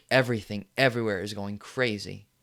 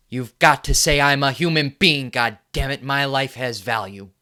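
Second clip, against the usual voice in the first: furious shout; 4 semitones higher, 9 dB louder than usual. Acted. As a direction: roar it, much louder and higher. Shouted rant to viewers.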